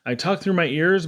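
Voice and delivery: plain reading voice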